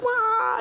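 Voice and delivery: high squeaky wah; mock kung-fu cry. Preacher mimics tiny heroine's battle cry, high wah. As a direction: yelp it like an action heroine. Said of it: "she's like".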